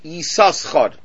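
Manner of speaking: Yisaschar, extra s sounded before the ch; Yisaschar with both shins. Shown as a demonstration. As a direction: sound the second shin in Yisaschar. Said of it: "really it should be pronounced".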